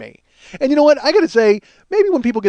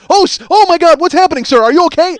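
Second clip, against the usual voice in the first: mock panicked cry, much higher and louder; alarmed staff; 11 semitones higher, 7 dB louder than usual. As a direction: imitate the staff panicking at the patient in the air. Staff panic at chair going up.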